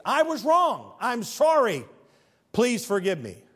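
high, loud declared apology; proclaimed apology. Shown as a demonstration. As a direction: call out the apology from the pulpit. Apology declared at preaching pitch.

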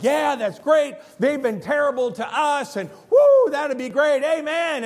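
loud, high cheering crowd voice with a whoop; whooping gleeful amen; excited crowd. Retold or imitated. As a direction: cheer like a congregation hearing enemies judged. Crowd cheering judgment on enemies.

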